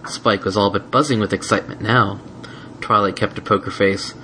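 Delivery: ordinary delivery, nothing marked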